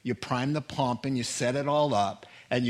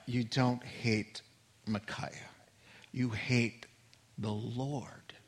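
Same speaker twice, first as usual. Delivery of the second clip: much softer, slow, down to a whisper; hushed; 3 semitones lower, 7 dB quieter, slower than usual. Real, not acted. Pastor confronting Ahab, fading to a whisper. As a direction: drop to a whisper to drive it home.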